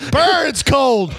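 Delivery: laughing Canadian impression; badly hidden Canadian. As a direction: exclaim in a playful Canadian accent. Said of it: "A Canadian who is not good at hiding".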